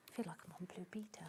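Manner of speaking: fast whispered joking aside; whispering. Real, not acted. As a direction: whisper the joke quickly. Joking aside while ironing.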